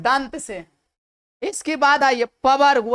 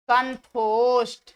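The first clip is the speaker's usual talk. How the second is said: slow, syllable by syllable कंठोष्ठ; slow spelled-out term; much slower than usual. Shown as a demonstration. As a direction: stretch कंठोष्ठ out slowly. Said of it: "क्या बन गए". (धीरे धीरे कंठोष्ठ)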